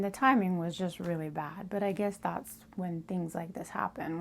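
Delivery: ordinary talk, nothing marked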